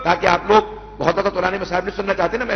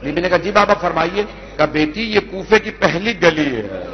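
measured, mournful majlis recitation; masaib recitation; 3 semitones lower than usual. Acted. Reciter telling the Kufa story to mourners, mournful. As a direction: chant the tragedy to the mourners.

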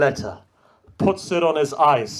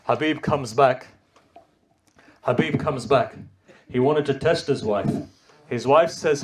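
ordinary talk, nothing marked

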